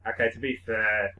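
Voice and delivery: normal talking voice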